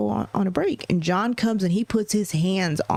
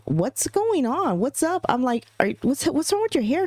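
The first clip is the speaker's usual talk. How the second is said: much higher, startled questions; alarmed surprise; 8 semitones higher than usual. Retold or imitated. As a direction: react to something shocking. Alarmed at son's hair.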